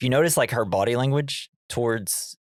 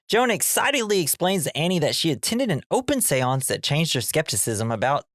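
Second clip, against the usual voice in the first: ordinary talk, nothing marked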